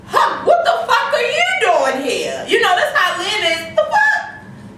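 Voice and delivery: high-pitched laughing what the; cackling, stunned, way up high; laughing disbelief. Retold or imitated. Retelling Lynn's shock at the surprise visit, laughing high.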